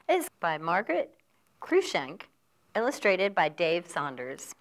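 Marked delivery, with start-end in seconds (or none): none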